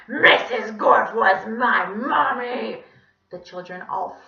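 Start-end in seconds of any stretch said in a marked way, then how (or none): 0.1-2.8 loud, slow wailing screech; anguished monster cry, drawn out; scary squawking villain. Acted. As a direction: shriek it like a sore-throated donkey villain. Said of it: "was even scarier than his real voice".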